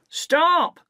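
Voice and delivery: shouting. A narrator's reading. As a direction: yell after the runaway. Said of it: "Mac shouts".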